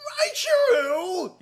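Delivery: high, loud, drawn-out scream. A narrator's reading. Jealous lover bursting in on her, screaming her name. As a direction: shriek her name in betrayal. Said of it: "he screamed".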